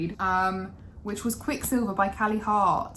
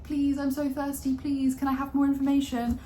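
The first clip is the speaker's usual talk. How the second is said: parched begging; 6 semitones higher than usual. Retold or imitated. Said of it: "you would be like".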